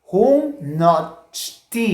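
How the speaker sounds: Junnochti said very slowly, syllable by syllable; teacher modelling Junnochti slowly; slow model word. Shown as a demonstration. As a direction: spell out Junnochti slowly for the class. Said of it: "De nuevo".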